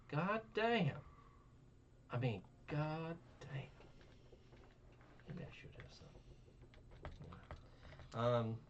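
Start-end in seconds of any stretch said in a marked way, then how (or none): 0.1-3.6 much higher, stretched god damn. Real, not acted. Still stunned by the story, high-pitched disbelief. Repeated exclamations. 5.3-6.1 quiet breathy whisper; whispered aside. Real, not acted. Joking aside to himself, in a whisper.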